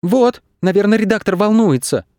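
put-on high, childlike worried voice; high-pitched childish concern; worried youngsters. A narrator's reading. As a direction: fret in a high kid's voice. Worried youngsters against the calm director.

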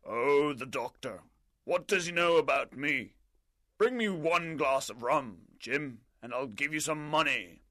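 high, dismissive sneer at the doctor; scornful captain. Acted. Captain scorning the doctor to Jim, dismissive. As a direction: wave the doctor away.

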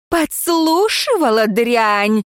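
high, slow, spiteful; hissing fury. Acted. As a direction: spit the insult down at her. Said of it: "зашипела она".

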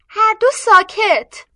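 high, loud, slow order; commanding. A narrator's reading. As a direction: silence them both firmly. Sister silences quarrelling brothers.

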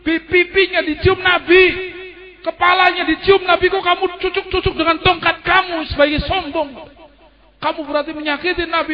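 berteriak tinggi dan keras memarahi Yazid; teriakan Zainab. Acted. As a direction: teriakkan dengan suara tinggi yang penuh amarah. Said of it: "Kemudian Zainab teriak". (Zainab berteriak marah pada Yazid yang mencucuk-cucuk wajah Husain)

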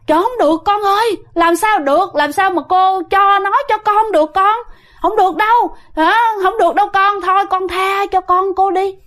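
mother begging a ghost, frantic; frantic pleading. Retold or imitated. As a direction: beg the spirit desperately to spare her son. Mother begs ghost for son.

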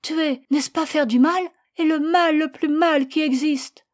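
put-on high, loud child's outcry; vehement, upset challenge; anguished protest. A narrator's reading. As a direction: argue back in distress. Distraught child argues with mother.

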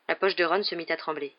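ordinary delivery

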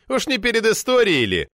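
high, sceptical query; scoffing. A narrator's reading. Member challenging the chairman on responsibility, scoffing. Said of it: "хмыкнул Флагелянский".

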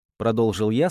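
usual speaking voice

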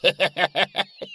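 high cackling hyena laugh; loud hyena laugh. Acted. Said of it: "громко рассмеялся, словно гиена".

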